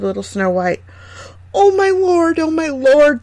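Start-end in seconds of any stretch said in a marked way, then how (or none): 1.5-2.4 very high, loud cry of wonder; astonished exclamation. A narrator's reading. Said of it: "they exclaimed". 2.4-3.2 high, loud repeated cry of wonder; astonished exclamation. A narrator's reading. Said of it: "they exclaimed".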